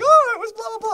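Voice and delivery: high, loud chirpy imitation of mom. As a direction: squeal it like an eager mom. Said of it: "She'd be like".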